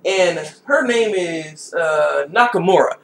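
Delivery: ordinary talk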